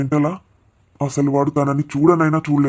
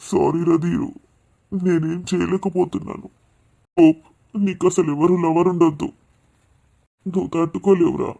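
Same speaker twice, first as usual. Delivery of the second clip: higher, tearful voice on the edge of sobbing; tearful pleading; 4 semitones higher than usual. Acted. Father apologises to absent son, near tears. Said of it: "ఆడపిల్ల ఏడ్చినట్టు ఏంటా ఏడుపు".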